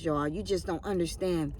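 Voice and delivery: ordinary talk, nothing marked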